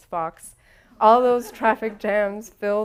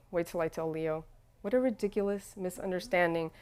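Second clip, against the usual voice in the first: ordinary delivery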